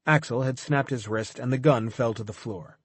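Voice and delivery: ordinary delivery, nothing marked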